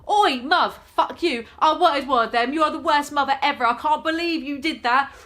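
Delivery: loud, high bratty tantrum; a cheeky baby yelling at mum. Retold or imitated. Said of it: "the baby's not gonna turn around and be like".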